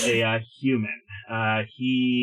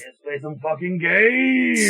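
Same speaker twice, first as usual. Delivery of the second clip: hyped-up cheer; 7 semitones higher than usual. Real, not acted. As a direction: kick off the game with a big rising holler. Hyped shout launching the game.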